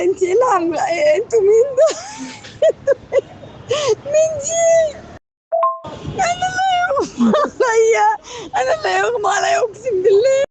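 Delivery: sobbing while she yells and swears; frantic mother crying out at her daughter; panicked crying shout. Real, not acted. Mother panics over fainted woman.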